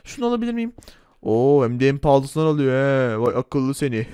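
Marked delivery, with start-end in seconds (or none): 1.2-4.1 low, bossy shopkeeper voice; gruff shopkeeper. Acted. Shopkeeper Erdal Bakkal teasing the customer, low gruff voice. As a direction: play the pushy corner-shop owner, pitched low.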